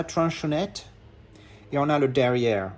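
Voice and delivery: put-on French words with a playful English accent; mock English accent. Reviewer showing the spine and back, mock English accent. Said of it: "je prends mon accent anglais".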